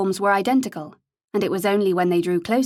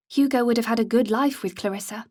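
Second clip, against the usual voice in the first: plain reading voice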